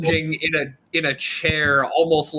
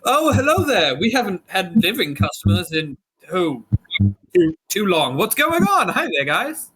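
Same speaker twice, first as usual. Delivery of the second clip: cheery tiefling shopkeeper. Acted. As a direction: welcome the living customers with eager charm. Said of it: "he goes".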